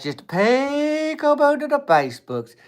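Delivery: very high and slow, stretched sing-song; a lingering high "Just peekaboo to the Facebooks"; drawn-out peekaboo. Pretend. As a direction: draw it out high like a lullaby tease. Repeats the peekaboo line stretched out.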